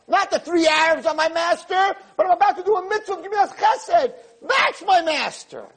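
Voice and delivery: very high, fervent exclamation; high-pitched zeal, drawing out the final declaration; impassioned insistence. Acted. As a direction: cry it out as an emphatic correction. Voicing Abraham's emphatic correction.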